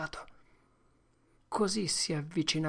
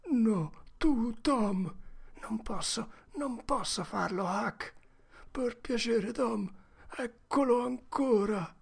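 put-on high, tense boy voices, urgent; two scared boys pleading in the dark; frightened boys; 8 semitones higher than usual. Boys pleading in fear, high and tense. Boys' dialogue voiced by narrator.

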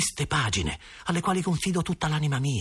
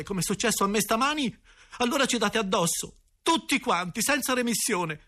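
higher, heated and insistent; a boy defending himself in a rush; a raised, aggrieved boy's voice; indignant tirade; 7 semitones higher than usual. A narrator's reading. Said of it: "e scattai".

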